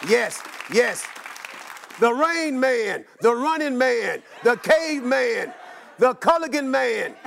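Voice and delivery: ordinary talk, nothing marked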